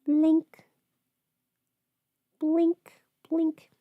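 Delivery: coaching herself to blink while applying mascara; blink cue. Real, not acted. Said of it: "applying mascara, self-cueing".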